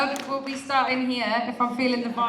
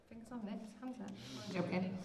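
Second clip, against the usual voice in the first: much softer, close and quiet; hushed joke; 4 semitones lower, 23 dB quieter than usual. Real, not acted. Host teasing the crowd to be silent, hushed voice. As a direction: drop to a near hush to tease them.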